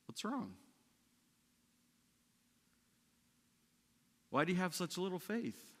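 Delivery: hushed retold questions; just woken. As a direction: ask it quietly and high, as if roused from sleep. Voices Jesus woken from sleep.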